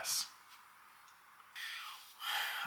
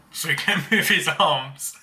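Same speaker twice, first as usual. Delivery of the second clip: laughing through the words, higher and louder; 6 semitones higher, 6 dB louder than usual. Real, not acted. Recalling brother stuck in the cover, laughing. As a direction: tell it through a real laugh. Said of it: "I laugh now".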